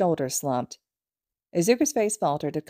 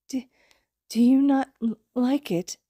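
trembling voice; 8 semitones higher than usual. Acted. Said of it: "Izuku's voice trembled".

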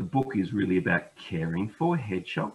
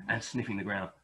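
ordinary delivery

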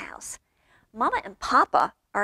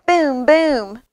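loud imitated bass beat; music booming; 3 semitones higher, 7 dB louder than usual. A narrator's reading. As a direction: thump out two resounding booms. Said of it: "goes the music".

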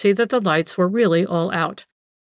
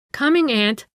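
high, light answering call; prompt reply; 6 semitones higher than usual. A narrator's reading. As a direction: answer your aunt straight away, pitched up. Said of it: "Anne immediately replied".

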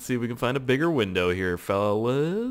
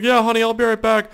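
cheery spouse voice; 12 semitones higher, 7 dB louder than usual. Retold or imitated. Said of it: "It's like".

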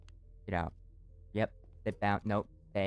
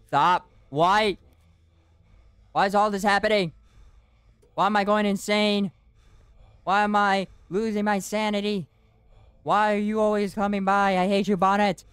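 frantic panic; 8 semitones higher, 4 dB louder than usual. Real, not acted. Frantic while being stalked.

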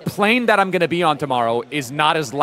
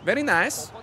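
higher put-on foreign accent, lightly done; quick cheerful catchphrase; Borat; 8 semitones higher than usual. Streamer gives chat the movie reporter's catchphrase. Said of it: "Do Borat".

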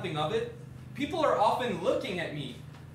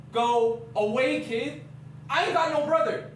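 angry dismissal; 4 semitones higher, 4 dB louder than usual. Retold or imitated. Man rejects claimed brother, loud and dismissive. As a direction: send the kid packing, loudly. Retelling his rejection of kid.

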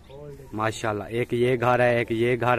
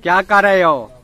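calling out; 3 semitones higher, 8 dB louder than usual. Real, not acted. Father calling child across room.

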